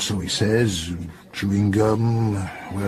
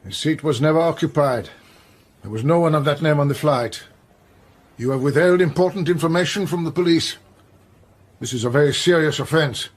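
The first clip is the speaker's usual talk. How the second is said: stern rebuke; 4 semitones higher, 4 dB louder than usual. Acted. Police inspector confronts lying clerk.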